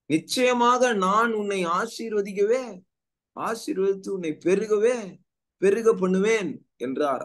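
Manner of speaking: high, emphatic verse reading; measured, ringing preacher's proclamation; scripture proclamation. Acted. Reads God's promise from scripture.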